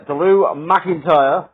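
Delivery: slow, drawn-out posh take on the name. Retold or imitated. Mock posh voice while announcing the challenger. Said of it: "I went a little bit posh there".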